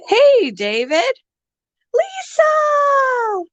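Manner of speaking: high excited call; excited high call to a friend. Real, not acted. Calling out to a viewer.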